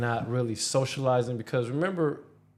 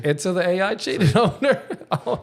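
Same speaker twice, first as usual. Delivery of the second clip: higher, louder, breaking into real laughter; laughing; 7 semitones higher, 7 dB louder than usual. Real, not acted. Podcast co-host telling friend's client story, laughing. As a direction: laugh through the punchline. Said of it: "I shouldn't be la".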